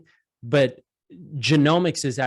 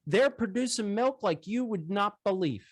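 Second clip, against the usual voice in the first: high folksy farmer voice; dairy farmer; 8 semitones higher than usual. Retold or imitated. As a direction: lift the voice way up for the farmer. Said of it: "He goes".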